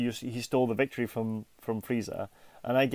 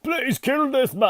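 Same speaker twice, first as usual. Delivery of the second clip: high, drawn-out tearful plea, hammed up; mock crying cartoon warrior; Vegeta; 8 semitones higher, 4 dB louder, slower than usual. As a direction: plead in a put-on, high dramatic voice. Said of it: "He was crying".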